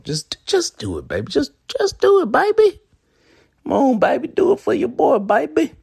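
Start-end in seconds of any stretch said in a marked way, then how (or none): 1.3-2.8 high squeaky falsetto, louder. Pretend. Repeat in falsetto. 3.6-5.8 high falsetto begging; squeaky high pleading voice. Pretend. Begging listeners to subscribe.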